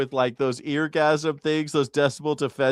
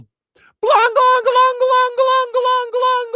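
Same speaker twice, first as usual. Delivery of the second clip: very high, loud clanging glongs; imitated church bells; 8 dB louder, much slower than usual. Business owner mocking bells across the street, loud clanging. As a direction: clang relentlessly like bells drowning out talk. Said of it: "the church bells start going up".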